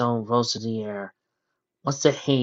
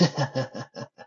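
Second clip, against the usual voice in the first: villain's chuckle; 2 semitones higher, 4 dB quieter than usual. A narrator's reading. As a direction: chuckle a bit, amused at his rage. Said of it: "He started chuckling a bit".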